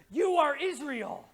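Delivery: ordinary delivery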